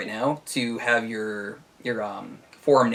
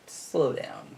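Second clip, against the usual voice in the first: quiet quote; 3 semitones higher, 7 dB quieter than usual. Retold or imitated. Quoting forum reply, whispered.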